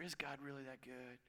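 soft, low, insinuating murmur; Satan's whisper. Acted. Satan whispering doubt in your ear, soft and low. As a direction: drop low and sow doubt. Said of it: "He wants to whisper".

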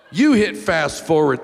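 ordinary talk

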